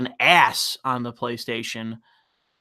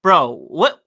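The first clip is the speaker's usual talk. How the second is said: ordinary talk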